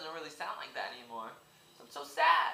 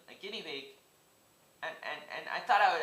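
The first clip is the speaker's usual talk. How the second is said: normal talking voice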